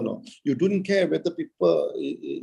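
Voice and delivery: usual speaking voice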